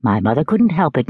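ordinary delivery

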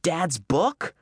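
a first stunned question; puzzled question. Acted. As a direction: ask it in disbelief. Meg questions brother in shock.

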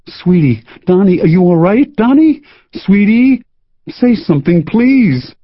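high, pleading, panicky; frantic worry. Acted. Sandy cradling her hurt boyfriend, high frantic pleading. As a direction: fret over him, voice climbing. Girlfriend over injured boyfriend.

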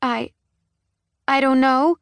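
put-on high, stammering scared child; frightened stutter. A narrator's reading. Terrified girl answering her father, stuttering. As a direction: stumble out the words in fear. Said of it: "she stuttered out".

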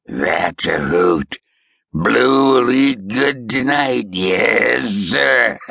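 slow, mocking old man's voice; amused old ghost. A narrator's reading. Ghost mocks Roan as he leaves, slow amused old voice. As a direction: relish it like a cackling swamp spirit.

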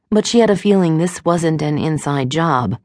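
usual speaking voice

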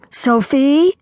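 high, rising call; lightly cried greeting; bright cry. A narrator's reading. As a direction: call out the name with a lift. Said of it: "she cried".

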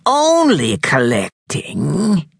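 slow, high, scornful crone; slow witch sneer. A narrator's reading. Witch doubting the boys' excuse, slow sneer. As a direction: drag it out with contempt. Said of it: "her voice would tear tin plate".

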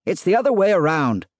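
high-pitched shouted correction. A narrator's reading. Husband contradicting his wife from upstairs, shouted. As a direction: holler the correction down the stairs. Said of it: "Andrew shouted".

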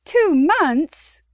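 blurted in shock. A narrator's reading. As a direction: burst out in shock. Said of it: "Chastity blurted".

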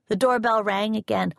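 usual speaking voice

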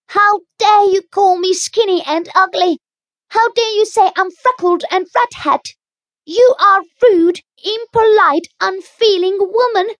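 put-on high, loud indignant child; a girl raging at an insult; furious girl. A narrator's reading. Anne's outburst.

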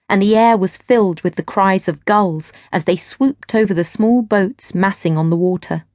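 plain reading voice

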